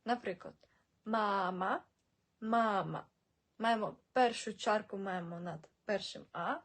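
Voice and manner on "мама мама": мама with the first "a" held long, "maaa-ma"; long first vowel. Shown as a demonstration. Teacher giving an example, мама with long first vowel. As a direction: stretch the first syllable of мама. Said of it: "ці літери ми читаємо довго".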